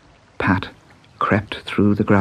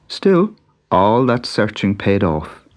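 usual speaking voice

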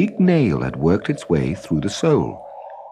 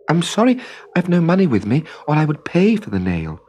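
put-on little girl voice; 8 semitones higher than usual. A narrator's reading. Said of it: "said Trudy".

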